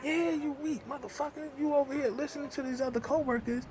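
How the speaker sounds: high, mocking jab; scornful retort. Real, not acted. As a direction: sneer it back at him. Retort to supervisor.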